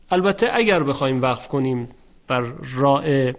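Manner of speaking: usual speaking voice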